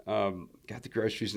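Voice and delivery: normal talking voice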